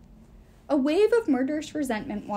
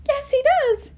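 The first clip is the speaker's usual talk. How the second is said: much higher, loud interjection; eager outburst; 10 dB louder, slower than usual. A narrator's reading. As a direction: blurt it out loudly and high. Inge eagerly cuts in.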